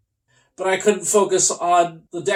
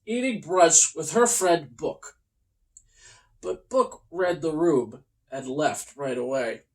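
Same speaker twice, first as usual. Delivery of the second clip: usual speaking voice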